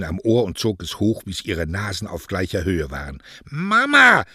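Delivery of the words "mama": high, loud, drawn-out yelp; protesting cry. Acted. Daughter yanked up by her ear cries out, shrieking protest.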